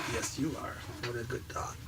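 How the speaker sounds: quiet, tender praise for the dog; praising the dog on the table, softly. Real, not acted.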